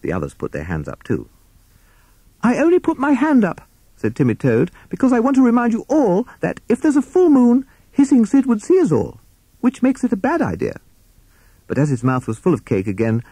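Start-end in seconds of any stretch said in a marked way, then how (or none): 2.4-3.7 very high, anxious toad voice; protesting Toad. Acted. 4.9-10.8 very high, anxious toad voice; protesting Toad. Acted.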